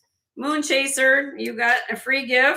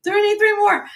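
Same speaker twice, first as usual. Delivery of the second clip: excited; 6 semitones higher, 6 dB louder than usual. Real, not acted. Hitting the thumbs-up goal.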